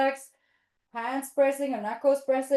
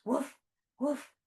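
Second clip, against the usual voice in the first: short, light little barks; two separate puppy woofs; dog woofs; 3 semitones higher, 3 dB quieter than usual. Pretend. Teacher plays dogs going gently, small woofs. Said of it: "Walking your dogs".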